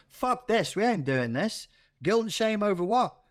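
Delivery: put-on high-pitched angry Liverpool accent; angry Scouser. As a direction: bark it like a furious Liverpool man. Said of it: "a middle-aged angry scouser in your mind going".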